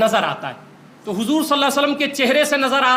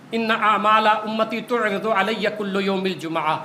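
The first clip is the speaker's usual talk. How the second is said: Arabic recitation; 3 semitones lower, slower than usual. Acted. Arabic hadith recited in sermon.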